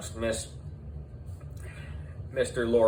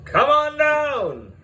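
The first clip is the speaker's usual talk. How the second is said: high, booming host announcing the contestants; game show host; 7 semitones higher than usual. A narrator's reading. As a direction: call them down like a TV quiz host.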